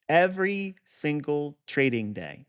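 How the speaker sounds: "Every single trading day" drawn out slowly, each word spaced; slow it right down and lean on "Every". Shown as a demonstration. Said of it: "Let me say it again, slower".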